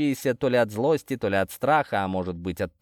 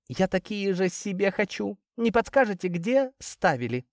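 high-pitched, thrilled woman; delighted exclamation; 9 semitones higher than usual. A narrator's reading. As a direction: gush with delight in a much higher voice. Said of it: "испытала восторг и воскликнула". (восторженно, высоким голосом)

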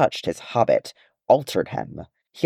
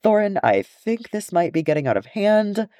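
high, nervous mumble; young dwarf Ori, high worried mumble; mumbling young dwarf; 11 semitones higher than usual. A narrator's reading. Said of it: "mumbled Ori".

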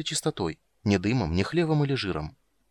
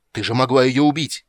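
loud, raised, outraged reproach; angry shout; 4 semitones higher, 9 dB louder than usual. A narrator's reading. Witcher berates dryad for shooting at child, angry shout. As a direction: loudly berate a reckless companion.

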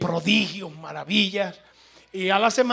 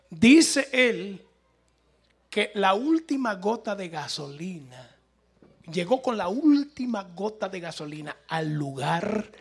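ordinary delivery

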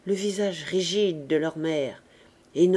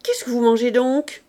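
loud sudden question at the maid; sharp challenge; 3 semitones higher, 6 dB louder than usual. A narrator's reading. Mistress catches the maid eating, loud sharp question. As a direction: snap it out, suspicious mistress. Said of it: "interpella la bonne".

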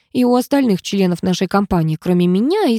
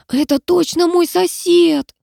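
high, drawn-out panicky squeal; hushed squealing; 10 semitones higher, slower than usual. A narrator's reading. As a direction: squeal it slowly, as if trying to whisper. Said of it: "шёпотом верещал он".

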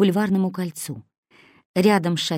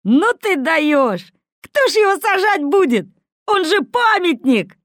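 slow, much higher, louder mocking voice; mocking condescension; 12 semitones higher, 7 dB louder than usual. A narrator's reading. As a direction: ridicule the silly question. Kosoy mocks Slavin's question. (насмешливо, медленно, выше и громче)

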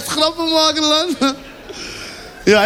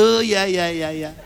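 lage mompelstem; 7 semitones lower, 4 dB quieter than usual. Acted. Hindustani driver voice low.

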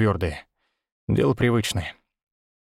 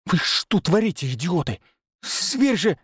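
higher, alarmed rebuke; urgent scolding; 6 semitones higher than usual. Acted. Hunter scolding the two clients, alarmed rebuke.

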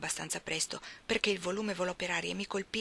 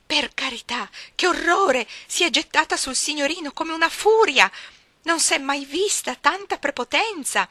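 much higher, louder shocked cry; scandalized maid; 8 semitones higher, 9 dB louder than usual. Acted. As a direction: gasp in horror at the girl. (cameriera scandalizzata, voce alta e forte)

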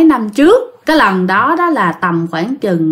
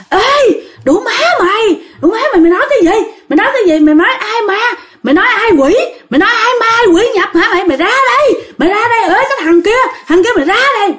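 high-pitched furious yelling with cackles; possessed shouting; 12 semitones higher, much faster than usual. Retold or imitated. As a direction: scream it like a possessed madman at the door. Possessed neighbor yelling at door. (giọng người bị nhập la hét chửi bới)

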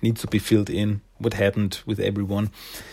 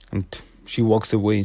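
normal talking voice

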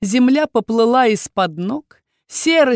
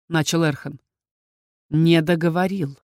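ordinary delivery, nothing marked

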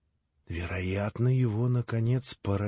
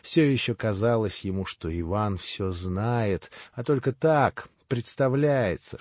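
usual speaking voice

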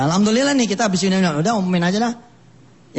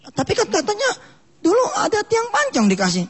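high sly needling. Acted. Devil baiting old man.

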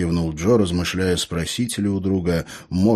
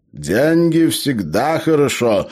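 slow, raised voice of a pondering gnome; sagely pensive; 8 semitones higher, 5 dB louder, slower than usual. A narrator's reading. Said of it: "глубокомысленно ответил гном".

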